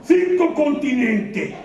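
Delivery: put-on high, slow, indignant kid; a kid scoffing at what the teacher said; lightly voiced indignant child. Child complaining to father.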